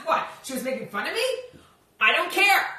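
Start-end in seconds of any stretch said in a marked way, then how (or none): 2.0-2.7 breezy dismissal. Acted. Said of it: "She was copying me?".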